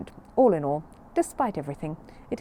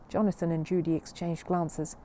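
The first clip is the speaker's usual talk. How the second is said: ordinary delivery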